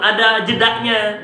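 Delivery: normal talking voice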